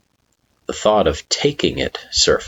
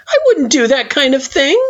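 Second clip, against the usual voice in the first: nervous pleading; 4 dB louder than usual. Acted. Frisk pleading.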